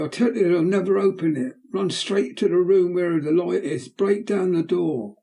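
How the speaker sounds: put-on urgent boy. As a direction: direct the crowd, frantic. Boy screaming to crowd.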